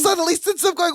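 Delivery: normal talking voice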